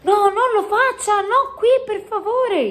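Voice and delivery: very high, urgent plea; alarmed pleading. A narrator's reading. Sofia begging the giant not here, alarmed. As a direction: beg them to stop, pitched right up.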